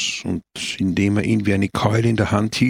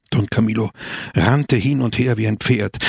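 usual speaking voice

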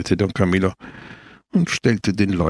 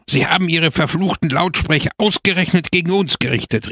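higher-pitched indignant outburst; empört; 7 semitones higher, faster than usual. A narrator's reading. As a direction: let the indignation pour out. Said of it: "seiner Empörung freien Lauf zu lassen".

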